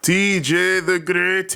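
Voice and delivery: grand ring announcer name call. Pretend. Grand self-introduction.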